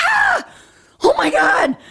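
high, loud re-enacted shriek of horror; retold horrified cry. Runner sees baby deer hit by car, retold horrified shriek. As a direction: yelp in shock, acting out the moment.

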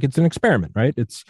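animated, high-pitched retold urging; Charlie Duke. Acting out guest urging an experiment.

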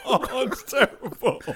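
high-pitched laughing words; wheezing with laughter; laughing hard. Real, not acted. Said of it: "Oh, my stomach hurts".

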